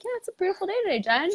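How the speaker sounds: high, bright sing-song to herself; cheery self-talk. Retold or imitated. Hiker alone in the woods, chirpy high self-talk. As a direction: chirp to yourself on the trail. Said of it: "kinda talking to myself".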